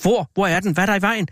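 startled high questions; urgent, frightened questioning without shouting; alarmed. A narrator's reading. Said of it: "rædselsslagen".

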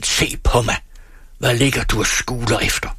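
father's irate challenge; shouted order. A narrator's reading. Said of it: "råbte han".

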